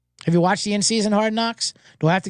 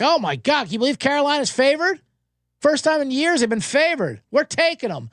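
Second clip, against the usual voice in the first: high, hyped-up fan voice; mock excited fan; 8 semitones higher than usual. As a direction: gush like an overconfident fan.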